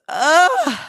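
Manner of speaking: high-pitched groan of exasperation; exasperated groan. Real, not acted. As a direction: let out a high, drawn "ugh". Exasperated reaction to release date news.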